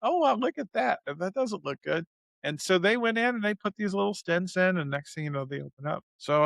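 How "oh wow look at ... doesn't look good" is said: dreamy, drifting wonder at the screen; sedated patient marvelling, lightly loopy; loopy and drugged. Retold or imitated. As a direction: play it half-loopy and amused. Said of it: "kinda loopy".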